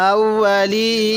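আউয়ালিনা recited slowly, vowels held; slow recitation. Shown as a demonstration. Teacher recites the joined word, slowly. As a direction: draw out আউয়ালিনা like tajweed. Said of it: "recited joined word".

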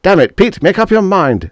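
high, loud impatient snap; snapping. A narrator's reading. Martin presses his hesitating neighbour, snapping. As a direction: snap at him to decide. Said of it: "snapped Martin".

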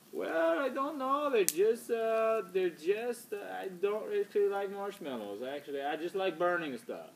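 put-on hesitant, high-pitched vague foreign accent; co-host from nowhere, groping for words in an odd accent; Marcio. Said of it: "didn't know where his accent's from".

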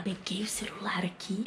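hushed whisper. Real, not acted. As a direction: whisper it quietly. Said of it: "eu já tô falando baixo".

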